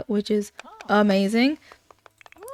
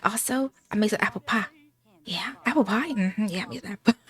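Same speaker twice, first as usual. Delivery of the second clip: softer, half-whispered offer; 5 dB quieter, faster than usual. Acted. Grandma offering pie to grieving girl, soft. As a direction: hush it like a little secret.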